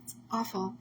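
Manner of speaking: soft whispered sorrow; barely voiced, sorry for her loss; hushed sympathy. Real, not acted. Guest whispers condolences to the grieving host. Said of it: "I'm so sorry".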